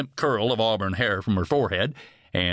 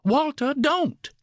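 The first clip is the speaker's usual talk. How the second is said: very high, desperate plea; near-panicked cry; slower than usual. A narrator's reading. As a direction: cry out to stop him, almost panicking. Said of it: "Ryder heard Carla cry, near panic".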